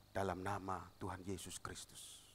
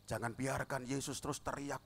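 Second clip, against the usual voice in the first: ordinary delivery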